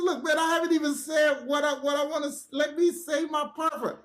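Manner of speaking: worked-up retold protest; heated protest. Arguing back in retold quarrel.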